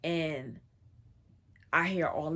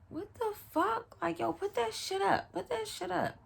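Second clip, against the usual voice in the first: much higher pitched urgent protest; retold alarm; 13 semitones higher than usual. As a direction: plead for it to be put away, not shouted.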